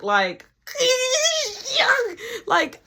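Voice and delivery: high, drawn-out mock squeal; exaggerated high fuming noises; imitated mad stomping-off noise. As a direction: act out a furious woman's wordless fuming. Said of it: "when a woman is mad as fuck".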